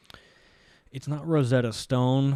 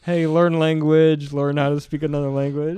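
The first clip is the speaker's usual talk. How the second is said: mock ad tagline; 2 semitones higher, 6 dB louder than usual. Ad announcer after the joke, lightly mocked tagline read. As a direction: deliver the commercial's closing pitch. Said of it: "And then they go".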